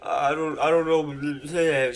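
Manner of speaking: trailing into mock-drunk babble; imitated drunk mumbling. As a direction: lose the words like a drunk friend. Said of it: "She couldn't even make words".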